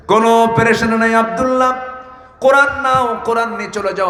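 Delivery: high, ringing preacher's call; full-force preaching. Real, not acted. Preacher voicing the Prophet reassuring Abdullah, impassioned preaching. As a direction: send it out high over the crowd.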